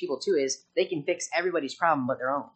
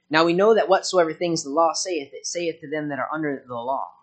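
loud preached reading of Romans; preaching scripture; 7 dB louder than usual. Acted. Preacher reads Romans to his church, loud preaching. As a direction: proclaim it to the congregation.

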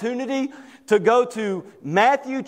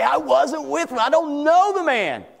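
raised, insistent acted protest; Peter's heated denial, acted; vehement denial; 7 semitones higher than usual. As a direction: deny it hotly, pitch pushed up. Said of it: "Peter began to curse and say".

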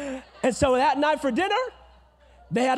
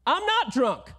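firm, louder defiant retort; clapping back; 6 semitones higher, 3 dB louder than usual. Retold or imitated. Hannah answering the priest, a touch of indignant shouting. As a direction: push back hard at the accuser. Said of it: "She claps back at the man of God".

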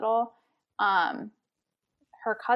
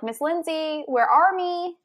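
put-on high, louder call of a small child; 6 semitones higher, 4 dB louder, slower than usual. Babysitter imitating the hiding little girl calling her, higher and louder. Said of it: "she would, like, yell".

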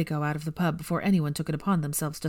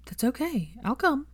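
lightly voiced Hermione, higher and gentle; comforting; 8 semitones higher than usual. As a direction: reassure him, voice lifting. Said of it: "laying a comforting hand on his arm".

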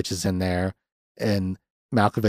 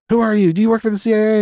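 much higher, louder mock demand; retold frantic grilling, pitched way up; frantic interrogation; 12 semitones higher, 5 dB louder, faster than usual. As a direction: act out a panicked man demanding answers. Acted confrontation after car crash.

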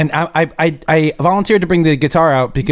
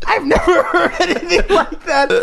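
laughing; 9 semitones higher, slower than usual. Real, not acted. Laughing so hard stomach hurts.

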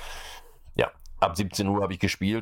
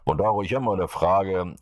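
usual speaking voice

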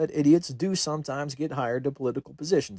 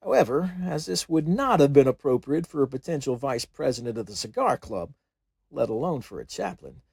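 ordinary delivery, nothing marked

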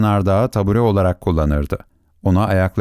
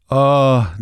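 cry out; 3 semitones higher than usual. Acted. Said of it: "diye haykırdı Küçük Prens".

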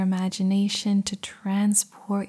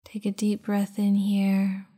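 guided meditation. Acted. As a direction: guide them into calm, unhurried. Leads a meditation.

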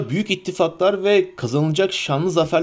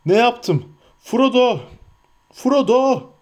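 calling out for Frodo; 9 semitones higher, slower than usual. A narrator's reading. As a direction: call after him in remorse. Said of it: "diye seslendi".

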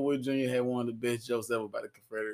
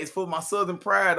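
mocking quote; 7 semitones higher, 7 dB louder than usual. Mocking flag defenders.

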